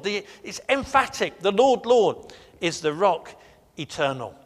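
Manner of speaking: loud, soaring preaching; full-force preaching. Acted. Pastor rouses the congregation, loud soaring preaching. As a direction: proclaim it to the back pew.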